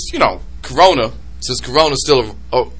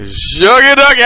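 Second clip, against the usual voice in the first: mock catchphrase; 7 semitones higher than usual. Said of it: "And he did this".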